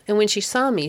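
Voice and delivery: usual speaking voice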